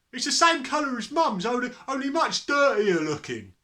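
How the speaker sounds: high, loud jeering son; loud accusing. A narrator's reading. Son compares Dad's hair to Mum's, loudly. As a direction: pipe up loudly over the row. Son continues the family row.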